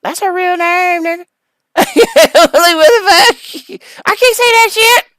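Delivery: high, loud incredulous rant; exasperated disbelief. Real, not acted. Fumbling [name]'s real name, high and incredulous. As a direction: shriek with laughing disbelief.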